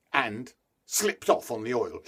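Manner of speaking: ordinary delivery, nothing marked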